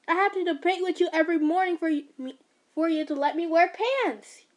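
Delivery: loud, high heated protest; angry. Acted. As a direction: keep pushing back hard. Son continues angry rant.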